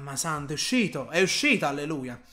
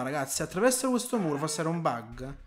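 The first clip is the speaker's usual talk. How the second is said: normal talking voice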